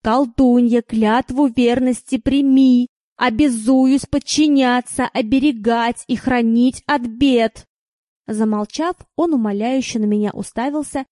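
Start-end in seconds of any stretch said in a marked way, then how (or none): 0.0-7.7 slow, louder, every word measured; slow and solemn. Acted. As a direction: hammer out each word solemnly. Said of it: "медленно и торжественно, чеканя каждое слово".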